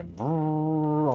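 long rising motor brrr; imitated motor noise. Picturing the motor on his kayak, imitated engine brrr. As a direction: trill out a mock motor sound.

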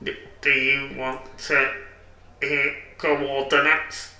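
stilted character voice; odd musician character. Acted. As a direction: space out the words strangely. Odd musician character offers coordinates.

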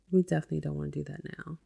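ordinary talk, nothing marked

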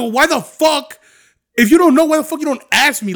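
throwing it back at friends who spread it; retold angry comeback.

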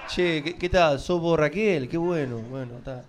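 light rioplatense lilt, casual greeting; playing an Argentine stranger on BlaBlaCar; mock Argentine accent. Fake Argentine accent to a BlaBlaCar passenger. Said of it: "pongo otro acento".

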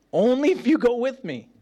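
high, whiny demand with YOU stressed; mocking child. Preacher echoes Barak's line as a childish demand. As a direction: repeat it like a clingy kid. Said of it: "repeats Barak's condition".

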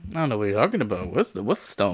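quick, quiet playing dumb; goofy clueless sitcom voice. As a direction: rattle it off innocently. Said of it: "[name] playing dumb".

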